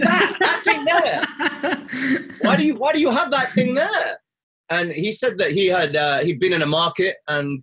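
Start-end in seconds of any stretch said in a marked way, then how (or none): 0.0-4.2 incredulous laugh. Retold or imitated. Baffled question to shaman.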